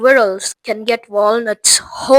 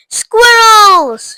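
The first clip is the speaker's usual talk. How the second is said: high, loud shout of glee; 11 semitones higher, 8 dB louder than usual. Acted. Veruca spots the squirrels, loud shout. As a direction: shout it out with delight. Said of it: "shouted Veruca Salt".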